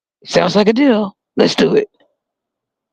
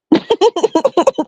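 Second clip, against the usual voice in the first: high laugh; 14 semitones higher than usual. Real, not acted. Laughs at own bit.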